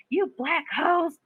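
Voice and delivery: a hint of a son's taunt, high and slow; mock insulting son. Mother imitating her son on social media, high mock taunt.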